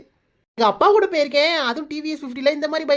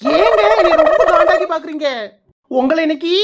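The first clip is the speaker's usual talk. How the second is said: much louder angry yell; furious; 6 dB louder than usual. Acted. Enraged wife yells as she chases her husband. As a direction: scream a threat at him.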